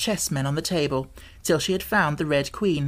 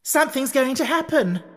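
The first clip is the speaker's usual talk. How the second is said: frantic warning; 8 semitones higher than usual. A narrator's reading.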